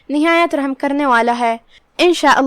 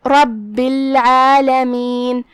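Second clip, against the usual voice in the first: drawn-out, melodic tajweed recitation; measured chanting of scripture; slow Quran recitation; much slower than usual. Shown as a demonstration. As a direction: recite each word slowly, with tajweed. Demonstrates the recited phrase.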